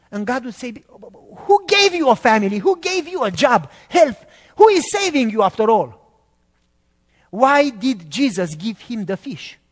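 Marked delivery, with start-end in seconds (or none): 1.5-5.9 high, loud rebuking questions; God rebuking. Acted. God rebukes a believer making excuses, high and loud. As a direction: demand who gave them everything.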